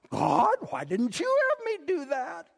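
high, whining mock protest; jealous complaint. Preacher acts out his jealousy to God, mock complaint.

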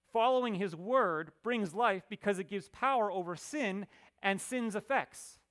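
high, ringing scripture proclamation; emphatic preaching. Acted. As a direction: proclaim it forcefully to a congregation. Preaching the point forcefully.

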